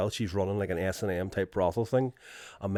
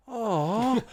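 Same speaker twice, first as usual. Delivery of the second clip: sympathetic aw; 13 semitones higher than usual. Retold or imitated. Mum pitying the Trump puppet, high sympathetic aww.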